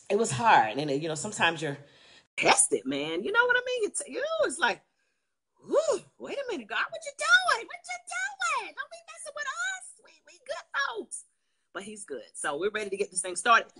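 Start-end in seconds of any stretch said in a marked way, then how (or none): 5.7-11.2 mock plea to God.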